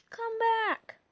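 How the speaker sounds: high pleading call; yelling. A narrator's reading. Ash begging Pikachu to return, calling out. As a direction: shout it after him. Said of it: "Ash yelled".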